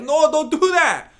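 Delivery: high, loud, slow protest; urgent pleading no; exclaimed no. Retold or imitated. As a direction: cry out no, pleading. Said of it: "I'm always like".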